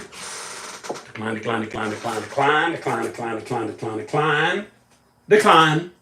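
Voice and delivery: low, quiet rattled-off repetition; rapid-fire muttering. Shown as a demonstration. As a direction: mutter it on a loop, barely voiced, rising at the end. Rattles off decline over and over.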